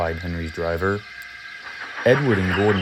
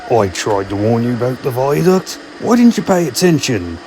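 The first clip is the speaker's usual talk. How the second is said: loud scolding, rising higher; cross; 4 semitones higher, 8 dB louder than usual. Acted. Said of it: "Old Bailey was very cross".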